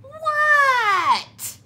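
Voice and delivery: high, loud yelled What; Froggy yelling back to mother. A narrator's reading. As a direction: yell back from outside. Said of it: "yelled Froggy".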